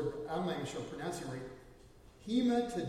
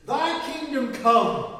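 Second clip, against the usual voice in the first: proclaimed prayer; 6 semitones higher, 12 dB louder, slower than usual. Acted. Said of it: "quoting the prayer".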